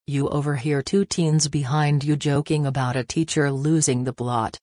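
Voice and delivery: plain reading voice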